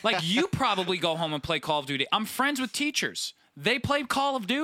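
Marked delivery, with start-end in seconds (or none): 2.1-4.6 mock teacher voice. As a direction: play a smug teacher, pitched high. Mock teacher voice, high pitch.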